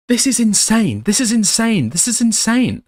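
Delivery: high, exasperated disbelief, said over and over; like you can't believe what you're reading; stunned disbelief. Real, not acted. Repeating in disbelief.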